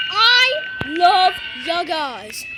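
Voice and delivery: very slow, stretched words; drawn-out gush. Real, not acted. Gamer drawing out his love for viewers, slow. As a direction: stretch it out lovingly.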